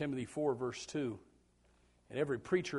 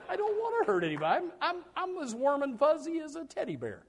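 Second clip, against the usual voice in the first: high-pitched playful insistence; mock-sweet protest; 10 semitones higher than usual. Coach assuring players he hurts nobody, mock-sweet protest. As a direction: plead harmlessness in a high, teasing voice.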